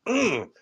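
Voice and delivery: high falling hum; hummed hook placeholder. Retold or imitated. Rapper searching for a hook word, high placeholder hum. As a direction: hum where the missing hook word goes. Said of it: "like, mm".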